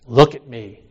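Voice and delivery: loud, firm command; strong command. Retold or imitated. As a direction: bark it like a stern teacher. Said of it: "kind of strong".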